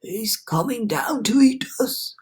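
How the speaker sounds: put-on slow, old grasshopper wail. A narrator's reading. Grasshopper fearing the cloud man, slow wail. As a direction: moan in dread. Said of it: "wailed the old green grasshopper".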